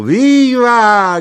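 long, very high full-voiced yell; drunken shout. A narrator's reading. Second drunkard topping the cheer, shouting. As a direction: bellow a drawn-out drunken cheer. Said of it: "gridò un altro ubriaco".

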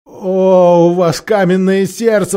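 exclaimed reproach. A narrator's reading. Captive knight to unmoved watchers, exclaimed reproach. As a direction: cry out against their hard hearts. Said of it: "воскликнул рыцарь".

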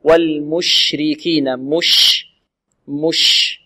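slow مش with a long, diffused sh; spreading SH. Shown as a demonstration. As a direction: let the sh in والمشركين hiss out and spread. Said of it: "نعطي الشين تفشيها".

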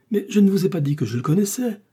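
panicked denial, pleading; desperate cry. A narrator's reading. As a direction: blurt it out in despair. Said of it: "s'écria Bonacieux au désespoir".

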